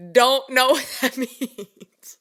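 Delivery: slow, laughing through the words; can't get it out for laughing; real amused disbelief. Real, not acted. Laughing about the purple remark.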